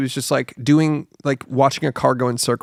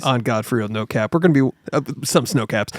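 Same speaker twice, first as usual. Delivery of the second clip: ordinary talk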